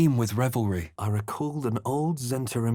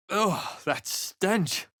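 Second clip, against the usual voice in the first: disgusted; 8 semitones higher, much slower than usual. Acted. Disgust at smell.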